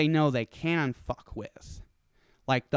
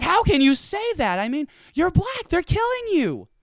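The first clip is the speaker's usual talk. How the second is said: high, pushed indignant protest; retold heated disbelief; indignant outburst; 14 semitones higher, 4 dB louder than usual. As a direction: demand an answer, incredulous. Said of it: "I'm just like".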